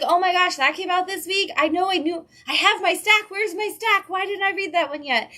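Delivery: excited. Retold or imitated. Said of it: "I'd be like".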